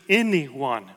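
"anyone" drawn out slowly; slow right down to underline "anyone"; stress on anyone. Shown as a demonstration. Preacher pointing out Jesus' words, slow emphasis on "anyone". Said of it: "Notice that word".

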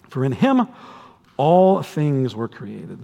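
"all" drawn out slowly and leaned on; reading that lingers on "all"; stress on all. Shown as a demonstration. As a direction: dwell on "all" in "all things were created". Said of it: "I emphasized the word all".

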